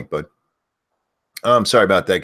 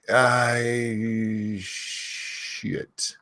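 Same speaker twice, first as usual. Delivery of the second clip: ordinary delivery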